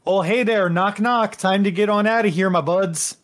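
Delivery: cheerful Canadian jailbreaker at the door; Canadian buddy. Acted. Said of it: "looks to you and goes".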